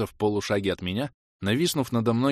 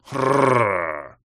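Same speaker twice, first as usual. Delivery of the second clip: loud rolling growl; beast roar; 4 semitones higher, 7 dB louder than usual. A narrator's reading. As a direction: roar like a wild wolf. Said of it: "взревел оборотень".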